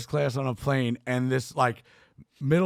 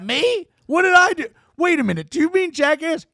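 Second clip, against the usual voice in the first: much higher, louder, incredulous; mock offended surprise; 11 semitones higher, 6 dB louder than usual. Bam reacting to being called asshole, incredulous and high. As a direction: act shocked, then catch on. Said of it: "And I'm like".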